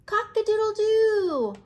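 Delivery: loud, high crowing cock-a-doodle-doo; rooster crow. Acted.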